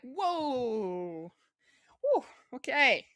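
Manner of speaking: loud, high startled whoa; surprised. Retold or imitated. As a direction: react as if it shocked you. Reacting to tense scene.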